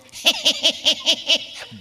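high, loud burst of laughter. Real, not acted. Laughing at the memory.

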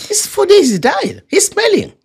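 very high-pitched, incredulous protest; Martha. Retold or imitated. Sister objecting to opening the tomb, high-pitched disbelief. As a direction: squeal the objection as a hammed-up imitation.

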